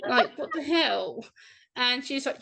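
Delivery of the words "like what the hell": slow, higher, disbelieving drawl; incredulous. Retold or imitated. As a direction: stretch it out in disbelief. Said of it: "I'm like".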